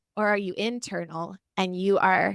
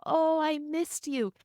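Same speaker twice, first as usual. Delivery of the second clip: tender apology; 7 semitones higher, slower than usual. Retold or imitated. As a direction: let it land gently as an apology. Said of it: "I had to go".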